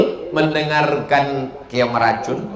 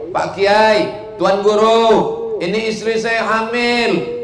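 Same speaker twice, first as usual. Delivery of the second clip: lightly acted, higher-pitched appeal; husband appealing to kiai; 9 semitones higher than usual. Husband telling the kiai his wife is pregnant, high appeal. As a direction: call out to the teacher, pitched up.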